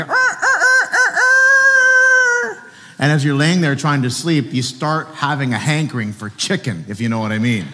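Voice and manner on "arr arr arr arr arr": very high, loud mock rooster crowing. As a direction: crow like a rooster at night. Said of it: "in the middle of the night, go".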